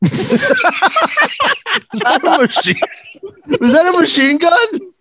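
surprised. Real, not acted.

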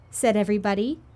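usual speaking voice

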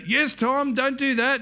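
a hint of a grandfather chiding a child; English grandfather. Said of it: "he's English".

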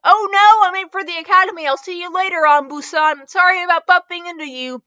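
high, rushed call-out; hurried yell. A narrator's reading. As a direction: shout an apology while dashing off. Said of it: "Naruto yelled as he ran off".

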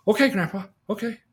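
put-on a boy happily agreeing with his grandpa; eager boy.

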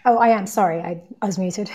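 usual speaking voice

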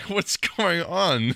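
high, slow, drawn-out confusion; baffled. Retold or imitated. Retelling own confused message.

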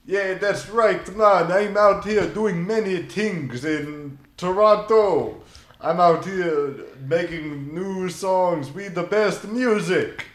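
lightly hammed-up [name] impression; mock [name] voice. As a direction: lay back and croon it like [name]. [name] voicing [name] on phone.

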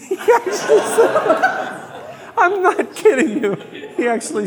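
high squeaky laughter through words; laughing. Real, not acted. Laughing at the preacher's boast, high giggling voice. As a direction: giggle helplessly as you speak.

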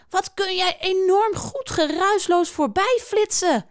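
high, animated call after someone. A narrator's reading. Schildpad compliments the lizard passing by, calling after him. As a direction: call it out, pitched well up. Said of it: "roept Schildpad hem na".

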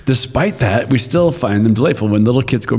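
usual speaking voice

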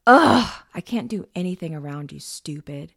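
loud exasperated groan, then easing off. A narrator's reading. Brother gives up on his sister and stomps off, exasperated. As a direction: burst out on the groan, then grumble the rest.

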